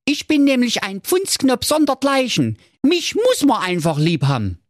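high cocky boast; Marco's voice. Acted. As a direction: brag like a charmer.

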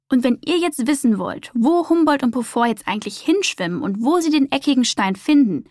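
usual speaking voice

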